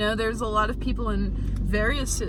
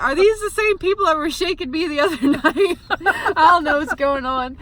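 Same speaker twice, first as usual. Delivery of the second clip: frightened, high and loud; panicked question in the dark; scared; 8 semitones higher, 9 dB louder than usual. Retold or imitated. Terrified in bed, hearing voices.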